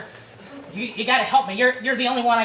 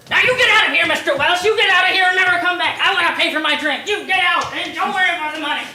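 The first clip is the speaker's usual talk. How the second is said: loud, higher, faster angry yelling; angry shout; 6 semitones higher, 6 dB louder than usual. Acted. Bartender yells at the customer to get out, furious. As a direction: throw him out, furious.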